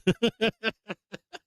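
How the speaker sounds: high-pitched breathless giggle. Real, not acted. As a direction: squeal with laughter at your own joke. Laughing at own zinger.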